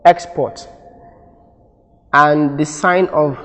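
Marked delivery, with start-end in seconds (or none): 0.1-0.7 "export" with first syllable higher and louder; stress on first syllable. Shown as a demonstration. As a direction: hit the start of "export", EX-port, then let it fall. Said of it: "pronouncing the first syllable".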